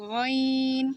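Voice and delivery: গইন with air rasped from the throat; throaty ghain. Shown as a demonstration. Teacher demonstrating গইন from the throat. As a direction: let air scrape out on গইন. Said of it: "গলার ভেতর থেকে বাতাস বের হবে".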